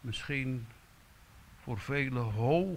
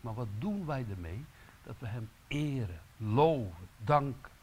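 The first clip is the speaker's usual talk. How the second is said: ordinary delivery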